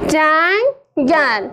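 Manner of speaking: جنگل split slowly as جن - گل; syllabified slowly. Shown as a demonstration. (هجا کردن آهسته جنگل)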